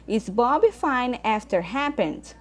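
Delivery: slow, clear reading of the English question; slow model reading. Shown as a demonstration. Teacher reading an example question to students, slow and clear. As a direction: spell it out slowly for the class. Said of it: "teacher reads example question".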